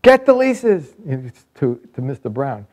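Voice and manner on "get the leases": high, loud yelled command; imitating an angry man's shout; retold scream. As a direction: yell it out as his urgent order. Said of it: "he screams".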